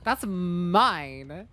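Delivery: high mock scolding over the stolen item; mock villain voice. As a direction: talk down to the survivor like a greedy bully. Voicing the killer to survivor.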